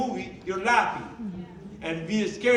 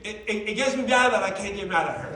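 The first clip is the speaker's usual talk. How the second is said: ordinary delivery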